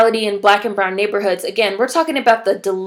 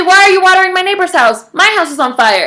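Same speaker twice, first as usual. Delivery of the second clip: mock alarm, raised and pitched up; acted indignant protest; 8 semitones higher, 7 dB louder than usual. Homeowner confronting firefighters watering the neighbor's house, indignant. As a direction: protest loudly as the wronged homeowner.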